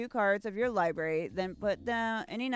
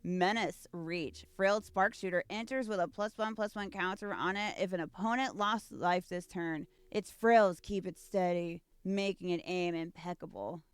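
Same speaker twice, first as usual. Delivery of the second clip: usual speaking voice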